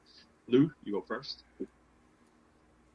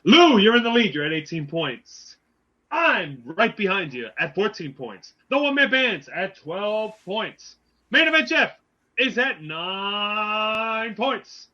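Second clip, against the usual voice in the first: auctioneer voice; 6 semitones higher, 6 dB louder, slower than usual. Acted. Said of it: "I had to get my auctioneer voice ready".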